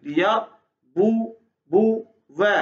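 लियरबुवा said very slowly, alif silent; slow recitation. Shown as a demonstration. Teacher recites the word slowly for the student to copy. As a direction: drag out लियरबुवा syllable by syllable. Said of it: "तो क्या पढ़ेंगे?".

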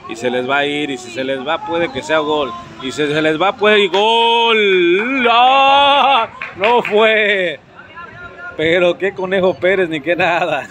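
rising to a near-goal shout; excited commentary. Real, not acted. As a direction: build up and shout at the near miss. Near-goal chance in match.

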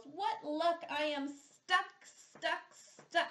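lightly voiced bear wailing stuck; Bear wails. Stuck Bear wails to his friends, mild mock wail. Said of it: "Bear wails".

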